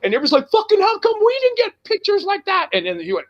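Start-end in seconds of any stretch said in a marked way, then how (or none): 0.5-2.7 very high-pitched mock whine; jealous complaint. Imitating everyone who got a plain picture, high mock-indignant complaint. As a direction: imitate envious fans griping.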